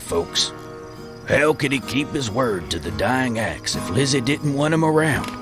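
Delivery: normal talking voice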